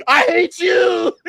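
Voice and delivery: slow, anguished mock shout; Anakin outburst. Son yelling at his father like Anakin, in a hammed-up anguished cry. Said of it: "I was like Anakin".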